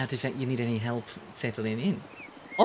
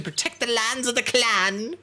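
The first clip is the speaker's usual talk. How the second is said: mock-heroic battle cry, pitched up; clan defender vibe; 9 semitones higher than usual. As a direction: declaim it like a clan warrior. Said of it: "They have the vibe to".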